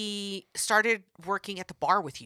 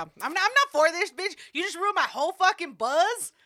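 put-on higher whiny drunk complaint; whiny drunk brother; 7 semitones higher than usual. Drunk brother complaining his buzz is ruined, high and whiny. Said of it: "voicing drunk brother's complaint".

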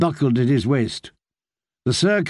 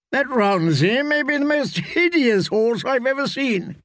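mocking jibe, pitched noticeably higher; a lightly voiced mocking knight; 10 semitones higher than usual. Sir Hyle mocking Pudrick's horse to Brienne, pitched-up teasing voice. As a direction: tease her about the ugly horse. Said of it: "said Sir Hyle".

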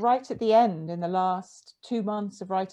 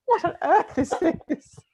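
incredulous Janice; 12 semitones higher, 3 dB louder, faster than usual. Retold or imitated. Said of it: "kind of looked at me and said".

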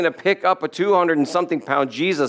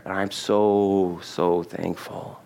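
slow, soft, heartfelt thanks; sincere gratitude; 7 semitones lower, 4 dB quieter, much slower than usual. Real, not acted. Preacher thanking God for rescue, slow and softly sincere. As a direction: let the thanks sink low and quiet.

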